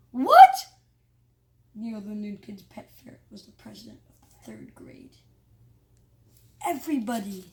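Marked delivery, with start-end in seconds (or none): 0.1-0.7 very loud, high shocked cry. Acted. Kids hearing a ferret won, loud shocked cry. 3.3-5.1 stunned whisper. A narrator's reading. Narrator stunned the ferret won, whispered.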